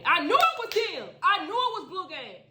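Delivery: high, loud furious shout; angry shout. Acted. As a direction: yell it in rage. Furious realization, climbing.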